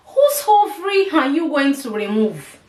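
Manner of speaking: dramatic biblical rebuke of the doctor, rising to a teary cry; mock rebuke. Rebuking the surgeon over her ovary, mock outraged. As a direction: cast out the bad news like a preacher. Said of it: "I was like".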